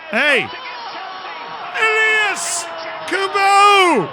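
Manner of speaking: high, loud, excited yell; real goal shout. Real, not acted. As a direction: shout the scorer's name at the top of your voice. Celebrating a goal.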